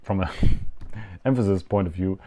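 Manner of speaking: ordinary talk, nothing marked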